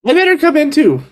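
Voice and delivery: put-on high-pitched twelve-year-old boy; Piper. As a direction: pitch it up as a small kid.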